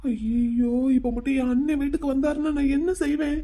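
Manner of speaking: high, softened nervous fretting; flustered. Acted. Young man dreads brother finding his visitor, flustered. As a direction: worry aloud, half to himself. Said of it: "நீ ஏன் இப்ப இவ்வளவு பதற".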